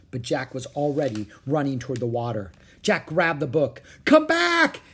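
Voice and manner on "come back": very high, loud plea; shouting. A narrator's reading. Annie to Jack at the water, urgent shout. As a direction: yell for him to return. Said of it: "Annie shouted".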